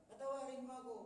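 pleading. Acted. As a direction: beg for forgiveness, voice lifted. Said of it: "repeated plea".

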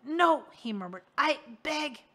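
high, loud panicked cry; terrified plea. Acted. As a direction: beg in sudden horror. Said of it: "A terrified whisper escaped".

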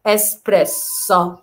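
اسبريسو spelled out slowly as es-pres-so; teacher repeats اسبريسو slowly; slow model word. Shown as a demonstration. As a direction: stretch اسبريسو slowly for learners. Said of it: "أول واحدة اس واحدة".

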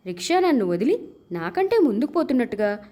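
usual speaking voice

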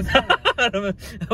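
short real laugh, high-pitched; laughter. Real, not acted. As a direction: laugh out in a quick burst. Laughs during burger mix-up.

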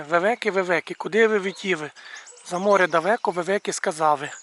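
put-on "Вевеки, вевеки" with every Л said as В, like a little boy who can't say L; lightly voiced child's rhyme with Л turned to В; imitating a boy's speech defect. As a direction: recite the rhyme swapping each Л for В, "вевеки" and "витіви". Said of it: "без букви Л".

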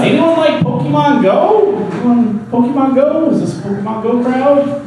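high, eager questions to the crowd; hopeful crowd check. Real, not acted. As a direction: pitch up, fishing for a response. Crowd work after bombed joke.

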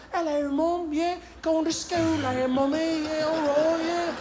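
put-on prim little British kid greeting Mom; little British accent. Young boy telling Mom he's off to school, light British accent. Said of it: "with a hint of a British accent".

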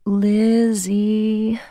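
high, soft Lizzie with the vowels drawn out; sweet, seductive.

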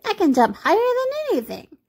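very high toy-rabbit voice; high-pitched storybook boast; boastful little rabbit. A narrator's reading. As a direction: insist in a high, small voice.

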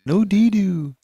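put-on dad quotes his youngest's word, high toddler voice. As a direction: say it like a stubborn toddler. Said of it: "my youngest used to say".

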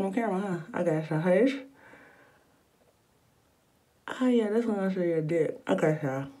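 lip pulled down while talking; speaking around teeth strip; fingers in mouth. Real, not acted. Seen 3: Fingers hold lower lip down, pressing strip onto bottom teeth.

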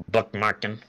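ordinary talk, nothing marked

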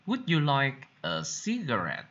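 ordinary talk

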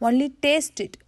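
high-pitched plea in mild offence; plead, a little hurt; offended. A narrator's reading. Pooh still pleading with Rabbit for honey, offended. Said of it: "offended tone of voice".

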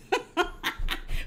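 real airy laugh after her own joke; a light chuckle at the memory. Real, not acted. Laughing at her own money story, a breathy chuckle.

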